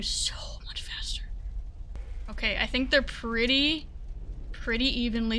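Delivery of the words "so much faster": hushed tense whisper. Real, not acted. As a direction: whisper it under your breath. Whispering while tense in game.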